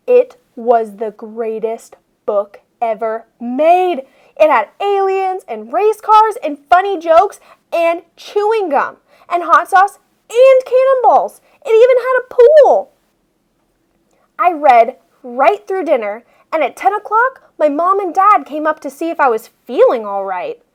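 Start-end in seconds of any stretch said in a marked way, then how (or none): none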